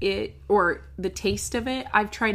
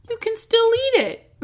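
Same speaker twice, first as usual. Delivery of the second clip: much higher, amazed punchline; mock amazement; 14 semitones higher, 6 dB louder than usual. Real, not acted. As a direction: sell it as a shocking discovery. Punchline about boiled yeast.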